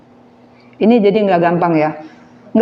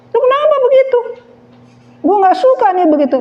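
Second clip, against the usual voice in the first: high, raised voice, the second line faster; mock angry confrontation; 13 semitones higher, faster than usual. Preacher acts a believer angrily confronting someone, high and raised. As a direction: act out a heated complaint.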